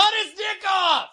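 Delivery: high, yelled heckle from a crowd; heckler shout. Retold or imitated.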